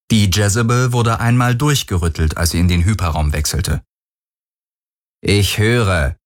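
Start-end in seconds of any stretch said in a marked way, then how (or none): 5.2-6.2 mildly grumpy captain; a touch of ill humour, held in; bad-tempered. A narrator's reading. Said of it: "denkbar schlecht gelaunt". (leicht missgelaunt)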